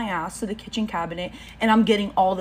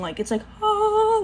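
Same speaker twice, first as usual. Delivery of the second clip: mock tranquil hum; 14 semitones higher than usual. Imitating the tranquil music.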